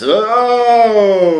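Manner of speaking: high, loud gasp of surprise; startled oh. Acted. Hero startled by the stranger, high and loud. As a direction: jolt at the sight.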